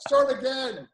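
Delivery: high, loud mock accent; Simon's accent. Coach to someone who fell, cheery put-on accent. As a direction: call it out in a hammed-up coach's accent. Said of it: "who will tell you".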